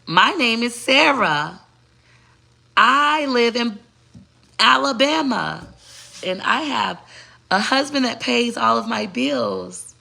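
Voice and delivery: high, drawn-out put-on voice; crazy persona. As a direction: introduce yourself in a high, very slow mock persona. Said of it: "Crazy would be".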